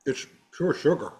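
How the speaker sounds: talking through a mouthful of candy; words round a bite of chocolate egg; mouth full. Real, not acted. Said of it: "I'm talking with my mouth full".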